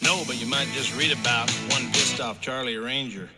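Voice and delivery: normal talking voice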